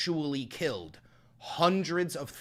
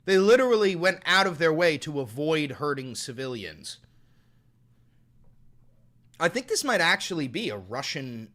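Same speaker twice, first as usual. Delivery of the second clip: ordinary talk, nothing marked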